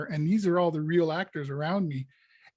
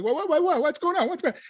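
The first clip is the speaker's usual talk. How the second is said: much higher, rapid frantic babble; 11 semitones higher, faster than usual. Retold or imitated. Prisoner in his cell, frantic high-pitched babble. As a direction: spin out in jittery alarm. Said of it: "I'm all frantic".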